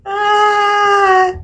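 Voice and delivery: high moved gasp; a surprised, moved cry; touched exclamation. Real, not acted. Touched by a listener's encouragement.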